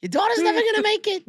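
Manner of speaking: put-on very high squeaky kid ump yelling him out; mock high-pitched ejection. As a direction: squeal at the dad like a tiny umpire. Young ump taunting the dad.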